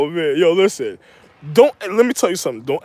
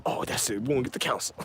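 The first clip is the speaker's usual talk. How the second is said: whispered aside; 8 semitones lower, 13 dB quieter, faster than usual. Retold or imitated.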